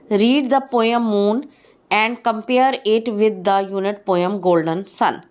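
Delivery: normal talking voice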